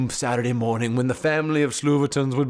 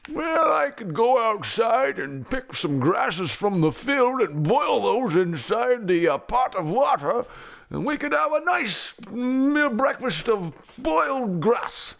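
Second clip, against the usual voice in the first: slow, swooping dad voice; leisurely singsong father; musing papa; 5 semitones higher than usual. Acted. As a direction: ponder it aloud, stretching the words. Narrator plays Papa's breakfast idea.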